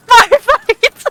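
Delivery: high, loud gleeful yell, repeated; excited shout. Real, not acted. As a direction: shout it again, just as thrilled. Player repeats the cheer.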